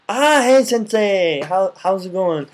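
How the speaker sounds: high and loud, teasing sensei; playful greeting. Pretend. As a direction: greet them like your teacher, joking. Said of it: "You're like".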